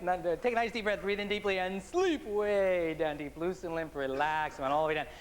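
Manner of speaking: slow soothing hypnotist voice; hypnotic induction. Acted. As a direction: lull them into trance.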